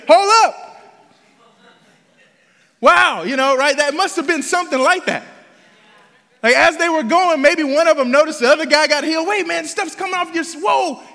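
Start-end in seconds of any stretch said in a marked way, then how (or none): 0.1-0.8 louder, higher sudden stop; startled halt. Acted. Acting a leper on the way to the priest, startled. 9.3-11.0 high, astonished blurt breaking into a whoa; amazed noticing. Acted. Acting one leper who noticed the other got healed, amazed.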